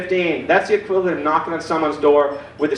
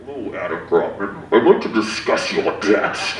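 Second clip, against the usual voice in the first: ominous bill collector; 10 semitones lower than usual. Retold or imitated. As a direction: menace him like death on the phone. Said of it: "I'm afraid to be like".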